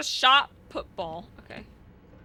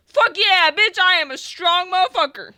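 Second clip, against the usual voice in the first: excited; 6 semitones higher, 8 dB louder than usual. Real, not acted. Celebrating a successful lift.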